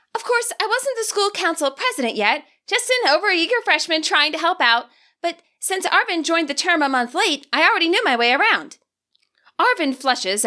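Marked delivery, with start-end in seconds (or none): 0.1-8.8 put-on high, eager student council girl; Nimona, chatty. Nimona tells Juliana about the tour, eager and high. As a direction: talk it up high and eager, a little proud.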